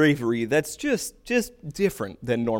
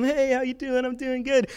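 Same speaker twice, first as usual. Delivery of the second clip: higher, breezy easygoing greeting; laid-back goalie; 9 semitones higher than usual. Retold or imitated. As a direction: toss it off like nothing matters. Imitating the chill goalie.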